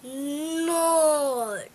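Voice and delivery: "Not" stretched very long; said slowly. Shown as a demonstration. Child copying the teacher's slow word, drawn out. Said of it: "say the word slowly".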